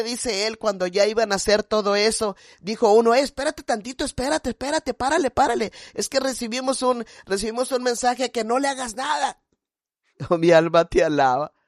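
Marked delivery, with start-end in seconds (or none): 3.1-9.4 higher-pitched captor calling a halt; urgent interruption. Retold or imitated. One captor stopping the others after a message arrives, urgent.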